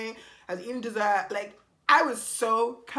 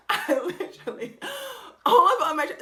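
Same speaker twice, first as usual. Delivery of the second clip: laughing disbelief; 9 semitones higher, 8 dB louder than usual. Real, not acted. Disbelief at date's revelation.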